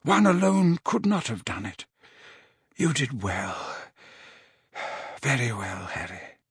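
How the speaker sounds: fading as he speaks; weak old wizard. A narrator's reading. Weakened headmaster praising Harry.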